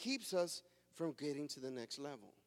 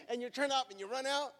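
ordinary talk, nothing marked

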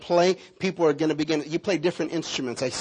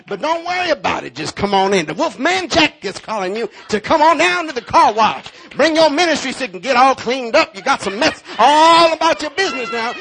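high, loud hyped radio announcer pitch; 7 semitones higher, 6 dB louder than usual. Retold or imitated. Preacher preaching the car wash sermon as a radio DJ. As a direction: sell the car wash like a wild DJ. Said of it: "with the radio voice".

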